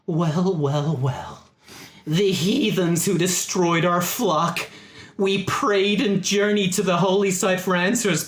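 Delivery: high, drawn-out holy indignation; accusing zealot. Acted. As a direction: denounce them like an outraged preacher. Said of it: "he notices all of you for the first time and says".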